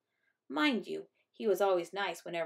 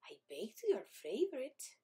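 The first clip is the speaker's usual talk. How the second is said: soft, slow, lilting near-whisper; sweet grandmother; 5 semitones higher, 6 dB quieter than usual. A narrator's reading. Bubby offering a wedge of rhubarb pie, soft singsong. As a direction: lilt it softly and sweetly.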